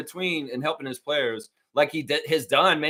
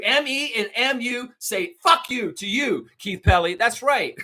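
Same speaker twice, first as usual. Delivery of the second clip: angry rant; 9 semitones higher than usual. Real, not acted. Ranting insults at the owner.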